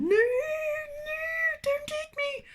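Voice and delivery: very high squeaky mock plea; mock terror. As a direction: squeal like a tiny victim. Friend being eaten, retold.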